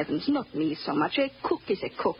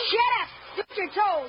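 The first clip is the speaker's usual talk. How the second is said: angry yell; 10 semitones higher than usual. Acted. Boy fighting with his playmate, angry yell. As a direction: yell it high, mid-scuffle.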